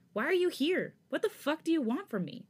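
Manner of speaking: a furious demand, voice leaping up. Acted. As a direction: hurl the questions at him in fury. Changbin furious at Felix.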